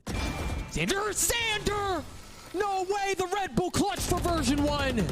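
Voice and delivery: high ecstatic shouted play-by-play; esports caster. Acted. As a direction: scream the winner's name like a caster. Clutch win call.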